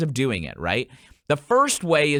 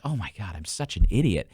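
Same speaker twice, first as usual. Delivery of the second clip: self-mocking; 9 semitones lower than usual. Coach retelling his gym slip, low and deflated. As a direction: groan it at yourself. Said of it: "And I was like".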